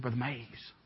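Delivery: low, very soft call; hushed appeal. Retold or imitated. Uncomfortable pastor to the visiting preacher, hushed first appeal. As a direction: discreetly try to get his attention.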